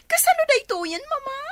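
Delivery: high, tearful, pleading; tearful daughter begging mother; crying plea. Acted. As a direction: beg her through tears.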